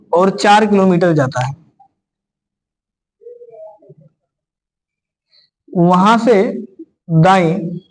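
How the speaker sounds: slow, paused dictation for students to write; feed the class the question phrase by phrase. Shown as a demonstration.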